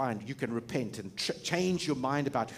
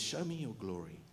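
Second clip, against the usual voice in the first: quieter, longing request; hushed, earnest Moses; soft plea; 5 semitones lower, 11 dB quieter than usual. Retold or imitated. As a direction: ask softly, yearning. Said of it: "He says".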